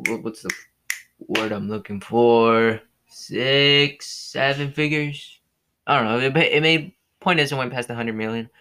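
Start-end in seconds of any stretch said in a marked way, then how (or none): none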